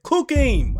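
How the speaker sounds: high, loud mock yell; bench yell. The guy on the bench yelling at his teammate, loud high call. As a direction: shout it from the bench. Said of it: "they said".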